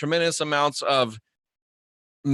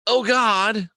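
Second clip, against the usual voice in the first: mock cry of passion; 6 semitones higher than usual. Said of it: "when you're calling out".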